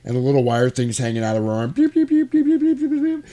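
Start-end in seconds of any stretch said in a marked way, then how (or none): 1.7-3.3 high electronic beep beep; high-pitched mock beeping; robot beeping. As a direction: squeak it out like a broken robot. Wire hanging out, robot malfunction.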